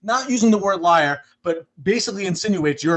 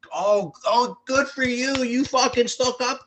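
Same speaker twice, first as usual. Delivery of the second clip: a mild, slow, higher mock of smug fans; mocking fake fans; 7 semitones higher, slower than usual. Mocking fake Giants fans cheering the reporter, higher and slow. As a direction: sneer it like a fake fan.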